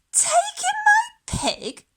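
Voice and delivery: very high shocked squeak; shocked old lady. Acted. Old lady aghast at taking in a pig, squealing. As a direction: squeal it in disbelief.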